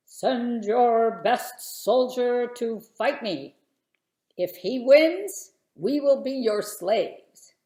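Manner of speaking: booming giant voice, loud and slow; giant's shout. A narrator's reading. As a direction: bellow it like a giant challenging an army. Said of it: "Goliath came out and shouted".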